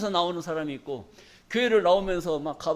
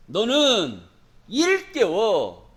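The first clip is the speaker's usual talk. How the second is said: slow drawn-out command; 3 dB louder, much slower than usual. Acted. Drawn-out scripture quote.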